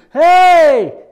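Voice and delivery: high, loud shout with a slow stretched warning; hollering down. Pretend. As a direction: yell a warning down the mountain. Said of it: "they start hollering down to you".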